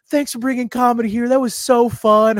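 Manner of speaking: high, cheery passer-by voice; bubbly mock enthusiasm, last words stretched; gushing thanks. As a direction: imitate happy fans calling out from a car. Passing car's happy thanks retold.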